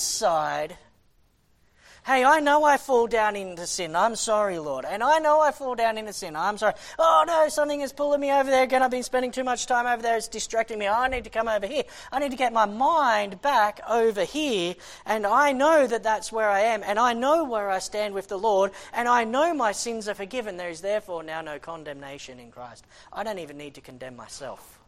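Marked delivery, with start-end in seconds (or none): 2.0-6.7 inner believer's voice. Acted. Preacher acts out a believer's inner voice. 7.0-10.2 louder, higher and quick, panicked; alarmed outburst. Acted. Acted alarm at being pulled away. 10.2-22.8 inner believer's voice. Acted. Preacher acts out a believer's inner voice.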